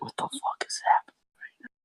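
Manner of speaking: high, breathless whisper. Retold or imitated. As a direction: whisper it in disbelief. Retold inner thought whispered.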